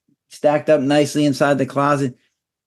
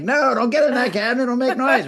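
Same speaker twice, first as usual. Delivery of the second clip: high mock scold at a dog, half laughing; 8 semitones higher than usual. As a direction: shoo the dog off the cabinet with a laugh.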